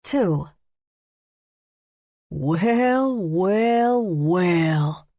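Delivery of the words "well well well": drawled well well well. A narrator's reading. Chapter opens with drawn-out greeting.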